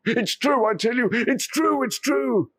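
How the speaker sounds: nobody believes you; crying out to mocking villagers; desperate shouting. Villagers laugh, he insists.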